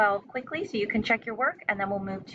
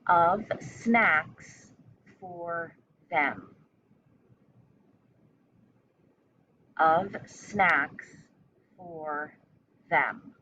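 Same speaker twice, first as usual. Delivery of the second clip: chunked into short phrases with pauses between; teacher dictating a sentence; slow dictation; much slower than usual. Shown as a demonstration. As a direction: dictate it piece by piece for young spellers. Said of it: "Second part".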